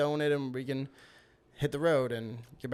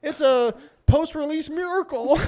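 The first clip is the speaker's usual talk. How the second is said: high, slow hammed-up proclamation; mock miracle proclamation; 13 semitones higher, 6 dB louder than usual. Released man hailing the cream donut, mock awe. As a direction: proclaim it like a wonder, pitched up.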